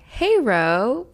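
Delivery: ordinary talk, nothing marked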